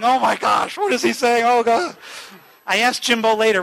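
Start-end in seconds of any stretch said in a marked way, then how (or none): 0.0-2.0 mock panicked prayer. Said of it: "He started praying".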